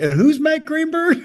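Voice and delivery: high-pitched imitation of a puzzled question; mimicked bafflement. Retelling her reply about Matt on a call, high-pitched puzzlement. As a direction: pitch it way up, playing clueless.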